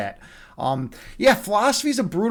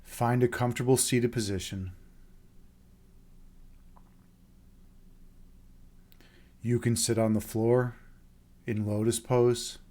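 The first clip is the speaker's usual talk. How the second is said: much lower, much softer, slow meditation voice; guided meditation; 9 semitones lower, 14 dB quieter, slower than usual. Acted. Host guiding viewers into meditation, soft and slow. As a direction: ease them down into stillness with long pauses.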